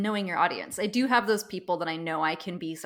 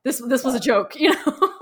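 laughing; 4 semitones higher, 7 dB louder than usual. Real, not acted. Retold texted joke, laughing.

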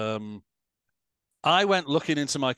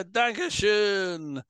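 very slow, raised, drawn-out "danke schön" in German; German thanks; 9 semitones higher than usual. Pretend. Answering the host's German joke with slow stage German. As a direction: drawl "danke schön" like a crowd greeting in German. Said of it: "Vielen Dank".

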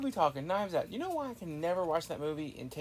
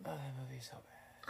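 breathy disgusted whisper; 6 semitones lower, 11 dB quieter than usual. Real, not acted. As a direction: hiss it in disgust. Groaning about hated movie.

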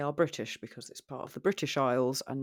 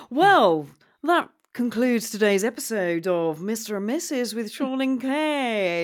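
announcer sign-off; 6 semitones higher, 8 dB louder than usual. Acted. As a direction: wrap it up like a show host.

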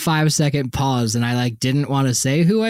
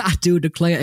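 Southern gentleman; 4 semitones higher than usual. Retold or imitated. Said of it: "He literally said shit like that".

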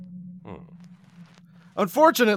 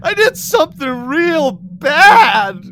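high, loud, slow wail; 8 semitones higher, 10 dB louder, slower than usual. Acted. Boy confessing to the sea creature, wailing. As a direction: sob it out. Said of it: "you wail".

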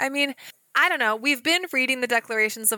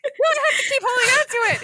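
panicked quote; 14 semitones higher, 4 dB louder, faster than usual. Retold or imitated. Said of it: "I was like".

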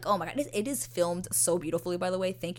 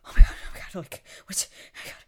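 breathless mock panic, mostly voiceless; freaking out; 12 dB louder, faster than usual. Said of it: "she is freaking out".

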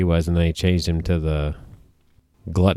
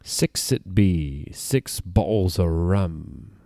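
pirate voice; much slower than usual. Retold or imitated. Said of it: "a now extinct line from an attraction".

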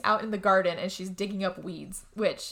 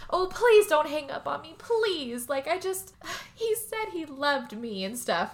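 high, whiny pleading voice, trailing off with a sigh; mock pleading Rose; 6 semitones higher, slower than usual. Rose on the phone begging Mary not to hang up, high and whiny. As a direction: play the begging caller, pitched up.

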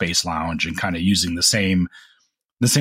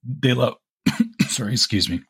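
coughing through the words; choking on coffee; 6 semitones higher than usual. Real, not acted. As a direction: choke on a sip of coffee mid-sentence. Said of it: "as I'm choking on my coffee".

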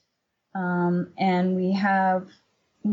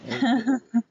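short real laugh; 3 semitones higher than usual. Real, not acted. Amused by struggling artist question.

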